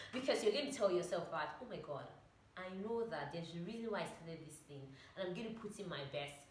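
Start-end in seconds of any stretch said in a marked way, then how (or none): 1.6-6.5 low, soft self-talk; quiet resolve. Acted. Speaker acts out a promise to oneself, quietly resolved. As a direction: lower and soften it, as if telling yourself.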